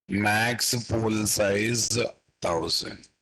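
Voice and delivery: very slow, word by word; slow dictation. Shown as a demonstration. Teacher dictating code to the class, very slow. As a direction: spell it out slowly so students can type. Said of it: "teacher dictates code slowly".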